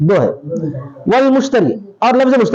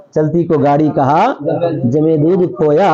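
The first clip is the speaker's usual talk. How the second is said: slow, lower-pitched verse recitation; 5 semitones lower than usual. Retold or imitated. Teacher repeating the quoted verse for students, slow low recitation.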